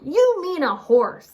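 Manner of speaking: high, loud correcting yell. A narrator's reading. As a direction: bark the correction. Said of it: "Boris yelled".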